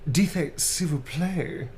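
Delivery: slow, laughing seductive French; hammed-up French charmer; romantic French lover. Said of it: "Romantic blushing type line".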